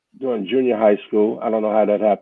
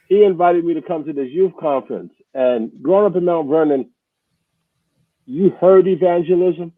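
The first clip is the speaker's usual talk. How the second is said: normal talking voice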